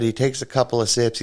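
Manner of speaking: usual speaking voice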